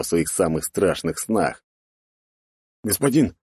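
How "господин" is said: higher-pitched soldier's voice; reporting warrior. A narrator's reading. Warrior interrupts the lord's brooding, higher urgent report. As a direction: call out a quick report to your lord. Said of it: "прервал ближний воин его тяжкие раздумья".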